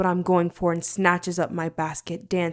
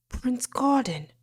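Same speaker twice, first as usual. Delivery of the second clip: urgent whisper; 6 semitones higher, slower than usual. Acted. Servant urges the prince.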